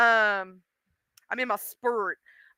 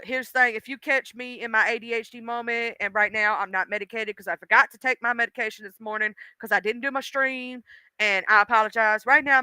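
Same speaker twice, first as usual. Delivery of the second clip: ordinary talk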